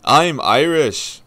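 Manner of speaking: loud, slow, hammed-up Irish accent. Shown as a demonstration. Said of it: "here's my Irish accent".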